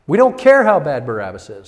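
loud, insistent mob voice; hate-filled crowd. Acted. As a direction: hit "care" hard as a defiant crowd. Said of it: "They are so filled with hate".

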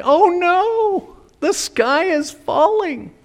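high, wailing alarm; hammed-up doomsday fright; mock panic. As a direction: cry it out high like the world is ending. Acts out a panicked onlooker.

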